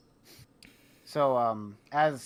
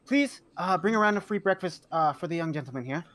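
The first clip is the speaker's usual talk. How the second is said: higher, polite order to the cook; bright instructions to staff; clerk giving orders; 6 semitones higher than usual. Acted. Clerk telling the cook to bring breakfast, higher voice. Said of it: "And she goes".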